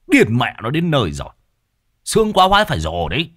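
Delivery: loud, ranting husband; loud, exasperated telling-off; mocking scolding. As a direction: berate her at full voice, scornfully. Husband scolds and mocks frightened wife.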